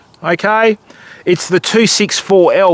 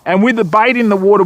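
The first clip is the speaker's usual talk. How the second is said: ordinary delivery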